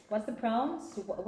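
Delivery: ordinary delivery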